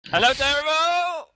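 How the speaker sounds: high, stretched-out hello; drawn-out greeting. Real, not acted. Caller greeting Darrow on the stream, high drawn-out call.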